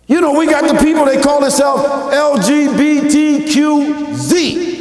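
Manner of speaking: high, loud, drawn-out letters at the end; full-force preaching. Real, not acted. Preacher announcing a name to the congregation, full-force preaching. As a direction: proclaim it loudly to the room.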